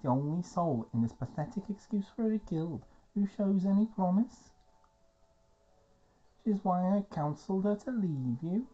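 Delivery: disdainful challenge in hammed-up Scouse; Scouse elf. Scouse elf condemns the guild, scornful. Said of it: "You can be your fucking Scouse elf".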